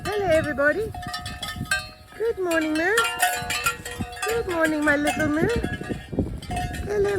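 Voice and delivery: high sing-song calling; high cheerful come-come call to the cows; cooing to cows. Real, not acted. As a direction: coax the herd in a high pet voice. Greeting and calling cows over.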